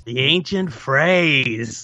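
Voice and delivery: drawn out slowly, mock portentous; mock-ominous echo. Cohost echoing the dream recap, mock-ominous. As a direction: stretch each word like a mystic pronouncement.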